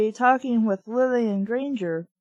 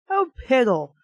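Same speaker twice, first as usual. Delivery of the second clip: lightly voiced prim old lady cursing; a hint of a fussy lady's oath; mild mock dainty exclamation; mild dainty curse; 2 semitones higher, 3 dB louder than usual. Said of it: "Really, piddle?".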